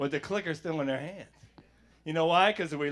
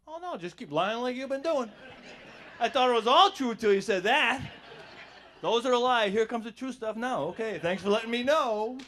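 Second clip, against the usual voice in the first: higher, dripping sarcasm; mock sarcastic reply; 6 semitones higher, 4 dB louder than usual. Answering the friend who admits lying, sarcastic. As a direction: roll your eyes at him out loud.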